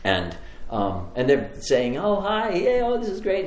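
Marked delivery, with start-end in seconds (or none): 2.0-3.5 higher, delighted party greeting, retold; mildly play-acted surprise at seeing guests; surprised greeting. Sister and husband greeting guests table to table, pleasantly surprised. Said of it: "they were very surprised".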